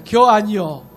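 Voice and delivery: 충청도 사투리로 툭; Chungcheong dialect. Retold or imitated. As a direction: drop it punchily in Chungcheong dialect. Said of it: "딱 세 글자예요".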